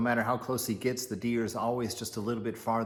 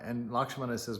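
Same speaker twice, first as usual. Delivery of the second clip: usual speaking voice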